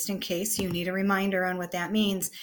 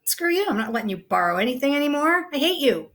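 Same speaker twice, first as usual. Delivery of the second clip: mock angry sister; 6 semitones higher, faster than usual. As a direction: imitate a furious teenage sister snapping back. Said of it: "she's mad at you and she's like".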